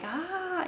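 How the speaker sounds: high strained "ah" of effort; pushing groan. Retold or imitated. Mother in labour pushing, high strained cry. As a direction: let out a pushing cry, not a scream. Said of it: "letting out, like".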